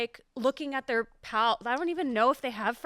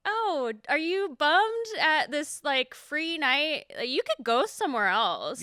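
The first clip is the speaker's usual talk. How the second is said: slow, drawn-out sarcastic concern; high-pitched start, stretched out with sarcasm; mock sympathy; 5 semitones higher, 3 dB louder, slower than usual. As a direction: needle a heckler with fake pity. Sarcastic retort to booing guest.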